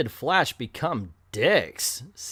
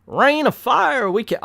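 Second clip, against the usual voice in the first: hammed-up Southern cry of alarm; Southern accent; 9 semitones higher, 4 dB louder than usual. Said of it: "gave the mayor an a, a southern accent".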